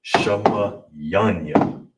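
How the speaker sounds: shamayanya drawn out syllable by syllable, very slow; teacher stretching shamayanya out slowly; slow careful demo. Shown as a demonstration. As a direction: spell out shamayanya slowly for learners, sha-ma-ya-nya. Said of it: "So all together".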